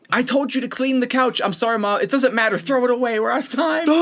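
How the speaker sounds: frantic mom. Retold or imitated. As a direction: voice a mom in full panic. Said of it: "It's like the mom freaking out".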